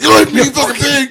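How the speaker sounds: high, loud, fast laughing screech; mock rabid yell. Rex snaps at apologizing Babe, fast high shriek. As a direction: spit it out like a rabid dog. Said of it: "And Rex's like".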